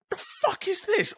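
high, astonished exclamation; mock amazed outburst; stunned disbelief. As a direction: react like you can't believe the riff. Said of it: "heard people going".

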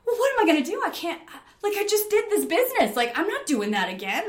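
high, loud re-enacted panic; overwhelmed climber freaking out, acted; panicking. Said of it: "I start to panic".

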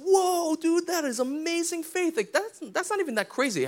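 high, amazed whoa, slowing on the praise; high, slowed, awestruck exclamation; amazed Jesus. Retold or imitated. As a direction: imitate Jesus blown away by faith. Jesus amazed at centurion's faith.

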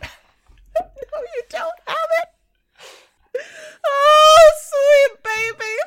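high, slow, teary-laughing coo; gushing through laughter. Real, not acted. Player gushing about the sweet baby boy character, high teary laughing coo.

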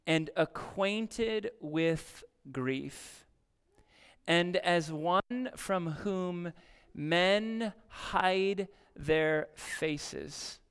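slow, measured reading of the verse; solemn scripture reading. Acted. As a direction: let each phrase land with long pauses.

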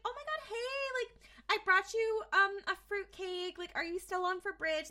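high-pitched friendly neighbor voice; imitated neighborly gush; chirpy neighbor. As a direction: chatter sweetly up high like a visiting neighbor. Acting a friendly visiting neighbor.

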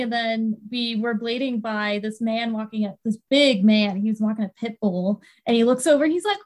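ordinary talk, nothing marked

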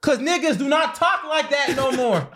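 high, drawn-out insistence; emphatic objection. Real, not acted. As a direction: spell it out slowly in a high, incredulous pitch. Emphatic objection in a heated debate.

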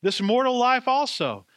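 rising, fervent hymn lines, slow at the start; hymn line recited. Acted. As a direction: lift the hymn words up with conviction. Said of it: "there's a line that says".

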